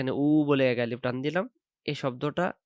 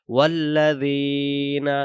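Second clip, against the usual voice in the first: drawn-out chanted recitation of ওয়াল্লাযীনা, louder; slow Quran recitation; 5 dB louder, much slower than usual. Shown as a demonstration. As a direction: recite ওয়াল্লাযীনা slowly with the doubled lam held. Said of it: "তাকে নিয়ে উচ্চারণ করে আমরা উচ্চারণ করব".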